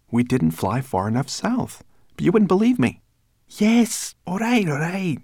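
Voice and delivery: high, loud character voice; grumbling brownie voice, high and forceful; testy brownie. A narrator's reading. Sorrel grumbles at companions.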